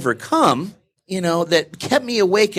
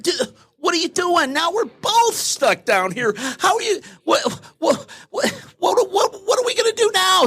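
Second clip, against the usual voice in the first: very high, frantic panic; panicked disbelief; 13 semitones higher than usual. Retold or imitated. Stuck man to friend who jumped in, very high frantic panic. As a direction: spiral into panic, ever faster. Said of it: "And the guy's like".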